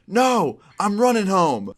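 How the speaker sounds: high acted shout from a distance; retold yell back. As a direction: imitate a friend yelling back over his shoulder. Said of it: "and he yells".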